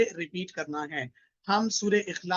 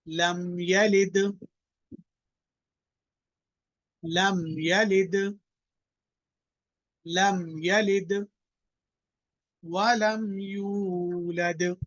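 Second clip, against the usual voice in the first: slow, melodic tajweed recitation with a long held final vowel; measured chanting recitation, the last word drawn out; Quranic recitation. Shown as a demonstration. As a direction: recite it slowly for the class to echo. Teacher drills student.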